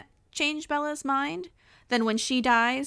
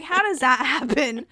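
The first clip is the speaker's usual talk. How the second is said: louder, puzzled retort; baffled; 2 semitones higher, 8 dB louder than usual. Retold or imitated. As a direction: ask it in loud disbelief. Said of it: "So I'm like".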